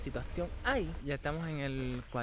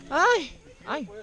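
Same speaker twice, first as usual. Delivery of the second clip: gasping shrieks at icy water; in ice cold water; 12 semitones higher, 8 dB louder, slower than usual. Real, not acted. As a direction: yelp high and loud from the cold shock. Lowered into cold cenote water.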